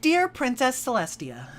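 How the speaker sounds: reading a friendship letter aloud to Princess Celestia; mock pony letter. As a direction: open the letter like a sweet pony pupil. Parodying pony's lesson letter.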